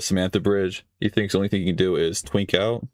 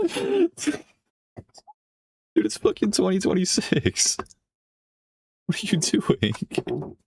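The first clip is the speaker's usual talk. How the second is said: high-pitched, drawn-out amused incredulity; laugh, then plead in a much higher voice; laughing disbelief; 11 semitones higher, much slower than usual. Real, not acted. Laughing disbelief at an old-style video.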